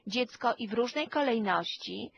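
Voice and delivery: normal talking voice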